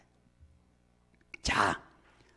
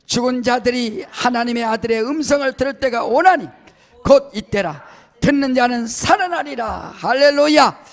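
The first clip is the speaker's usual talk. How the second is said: louder, building to a shouted hallelujah; loud group scripture reading; 5 semitones higher, 7 dB louder than usual. Acted. Preacher leading the church in reading the verse aloud, loud. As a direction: read it out boldly with everyone.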